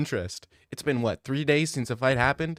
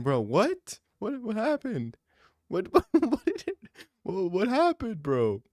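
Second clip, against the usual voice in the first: mock Jesus, laughing; 11 semitones higher than usual. Jesus confronting DDP in heaven.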